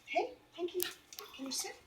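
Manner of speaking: high, soft coaxing to the dog; soft dog talk. Shown as a demonstration. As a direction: gently ask the dog to sit. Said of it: "I'm doing it softly".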